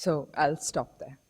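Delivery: ordinary talk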